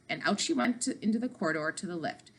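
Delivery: ordinary delivery, nothing marked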